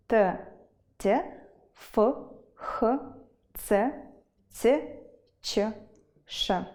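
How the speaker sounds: "б, в, г" to "ц, ч, ш" as isolated sounds, not letter names; consonant sounds demonstrated. Shown as a demonstration. Teacher listing Ukrainian consonants, each as a separate sound. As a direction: sound out each consonant singly, pausing between them. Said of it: "Та приголосні".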